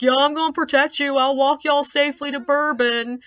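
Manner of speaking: high, loud mock calling-out; mocked protective husband. Husband trailing the women toward Bourbon, mock protective call.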